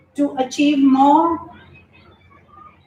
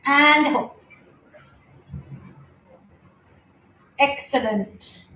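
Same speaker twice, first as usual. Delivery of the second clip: yoga instructor cueing; 3 semitones higher, slower than usual. Acted. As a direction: guide the pose, then hush the praise. Leads yoga poses.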